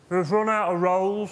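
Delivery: slow, drawn-out shop-counter sing-song; bakery assistant voice. Retold or imitated. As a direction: stretch it like a bored shop girl. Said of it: "in the same voice".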